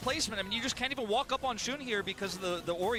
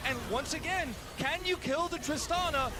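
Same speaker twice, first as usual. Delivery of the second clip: energetic play-by-play, pitched up; esports caster; 7 semitones higher, 7 dB louder than usual. Acted. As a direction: call the fight like a live caster. Live play-by-play of teamfight.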